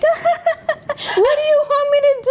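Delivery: a laughing friend pleads on the phone, high and giggly. Retold or imitated. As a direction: quote her giggling helplessly. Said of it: "just cracking up from start to finish".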